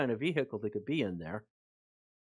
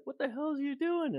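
much higher, startled and alarmed; 14 semitones higher than usual. Retold or imitated. Scared corporal sees his buddy put down the gun, alarmed high cry. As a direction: blurt it out in disbelief. Buddy unloads weapon near unknown light.

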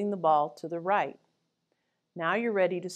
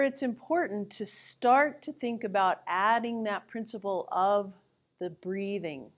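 normal talking voice

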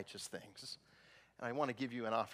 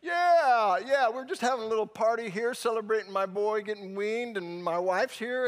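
loud, much higher, easygoing host; casual party guy; 10 semitones higher, 9 dB louder than usual. Retold or imitated. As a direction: brush the cop off, all smiles. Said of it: "And the cop's like".

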